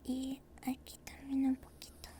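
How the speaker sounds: soft, breathy close-mic whisper throughout; tender roleplay dentist whispering to a patient; ASMR whisper. Acted. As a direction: whisper gently like a dentist soothing a nervous patient.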